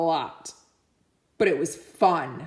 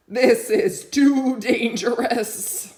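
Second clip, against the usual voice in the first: slow, a bit louder, fretful; nervous sheep; 4 semitones higher, 5 dB louder, slower than usual. Acted. Sheep refusing to climb on, anxious and slow. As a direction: say it like a worried sheep.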